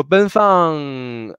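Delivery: slow "Ben Fang", high then gliding down; drawn-out tones. Shown as a demonstration. Teacher breaks down her name, slow high-to-falling "Ben Fang". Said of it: "Ben is the first tone".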